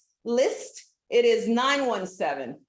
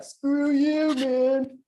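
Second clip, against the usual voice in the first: mock Sprint; 5 semitones higher, 4 dB louder, much slower than usual. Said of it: "I think Sprint is like".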